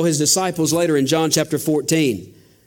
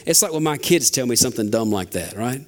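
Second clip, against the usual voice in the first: usual speaking voice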